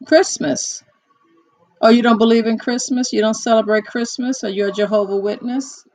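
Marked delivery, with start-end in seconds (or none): none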